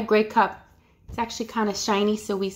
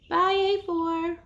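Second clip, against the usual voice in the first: high, slow mouse goodbye; little mouse; 7 semitones higher than usual. Pretend. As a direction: wave off the class as a mouse. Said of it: "I'm a gray mouse".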